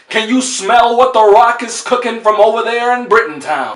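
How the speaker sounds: loud, high-pitched wrestling catchphrase shout; Dwayne Johnson impersonation. Acted. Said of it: "Dwayne Johnson, then he'll be like".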